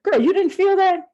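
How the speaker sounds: shocked she didn't notice; on the bumpy plane, astonished at the friend; alarmed disbelief. Retold or imitated. Turbulence, alarmed at calm friend.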